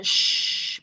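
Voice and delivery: "shh" whispered, soft and stretched; a soft hushing "shh"; soft sh sound. Shown as a demonstration. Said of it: "es un sonido más suave". ("shh" suave y alargado)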